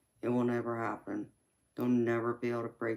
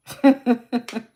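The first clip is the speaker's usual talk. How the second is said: loud, high-pitched cackling laugh; real laugh; 12 semitones higher, 14 dB louder than usual. Real, not acted. Streamer joking about trolls with viewers, loud high laugh. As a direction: crack up loudly, high and rapid.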